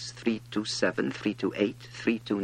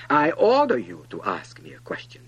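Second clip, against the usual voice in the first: loud royal command; hasty king; 8 dB louder than usual. A narrator's reading. King hurrying to assure the prince, loudly commanding. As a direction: jump in with an order. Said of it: "the king hastened to assure him".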